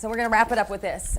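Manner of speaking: ordinary delivery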